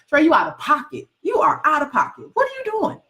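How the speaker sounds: high-pitched mock yell at herself; imitating yelling at herself. Author retelling how she yells at herself, mock yelling. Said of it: "I yell at myself".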